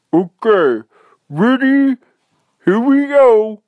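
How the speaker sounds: high, loud, mouth-full bear voice; muffled words, excited and rising in pitch; talking through teeth. Acted. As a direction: call it out with teeth clamped on something. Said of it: "grabbed onto her seat with his teeth".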